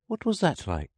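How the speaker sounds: put-on high, curious little girl; Alice voice. A narrator's reading. Alice asking about fainting in coils, high and curious. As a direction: ask in a child's high, light voice.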